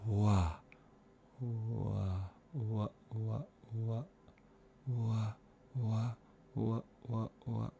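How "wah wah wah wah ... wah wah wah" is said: soft light wahs with no hiss; light closure wah. Shown as a demonstration. Coach demonstrating minimal closure on wah. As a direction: keep the wahs soft but clean. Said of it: "minimum amount of narrowing required".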